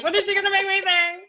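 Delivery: high, loud mock panic; mock nervous. Coach playfully voices the anxious client, mock nervous. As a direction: voice shoots up in pretend nervousness. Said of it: "getting a little bit nervous here".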